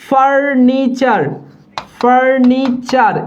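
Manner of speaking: "Furniture" drawn out in three slow syllables; teacher's slow, divided "furniture"; slow syllable demo. Shown as a demonstration. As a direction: stretch each part of "furniture" as for dictation. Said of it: "এই furniture বানানে মূর্ধন্য বসবে না".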